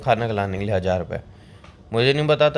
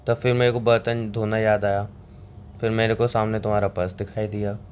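ordinary talk

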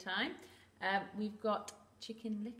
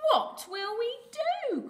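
high, loud, slow wail of alarm; crying out; 9 dB louder, slower than usual. Acted. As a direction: wail helplessly as a panicked duck. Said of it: "cried Ducky Lucky".

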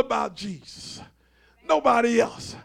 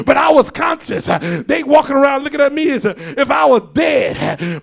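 loud, raised, driving preacher's cadence; full-force preaching; 3 semitones higher, 9 dB louder than usual. Acted. Preacher retells Paul's testimony to the congregation, full-force preaching. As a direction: preach it hard, punching out each phrase.

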